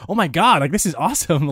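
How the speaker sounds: retold excitement, starting high and easing off; lifted, excited reaction; kinda hyped. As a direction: act out your own mild fan hype. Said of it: "I was even kinda hyped".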